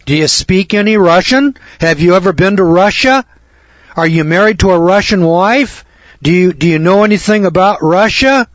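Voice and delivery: acted senator questioning. Senators quiz an unqualified nominee about Russia, mildly incredulous. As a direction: lightly play a senator grilling the nominee. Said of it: "bring him in to this Senate hearing".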